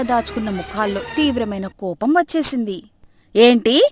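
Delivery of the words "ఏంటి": loud shocked outburst; can't believe no salary. Acted. Women shout in shock at the son, loud.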